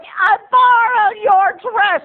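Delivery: high, drawn-out squabbling screech; hammed-up sisters' fight, screamed slowly; screaming aunt. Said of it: "they were fighting over".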